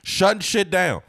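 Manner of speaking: high, loud celebratory call; hammed-up hype. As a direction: crow it like a hype man. Hyping comedian's handling of heckler.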